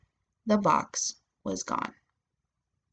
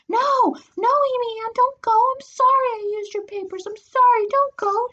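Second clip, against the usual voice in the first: very high, desperate pleading; little sister crying out; 4 dB louder than usual. A narrator's reading. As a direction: beg your sister not to leave. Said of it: "she cried".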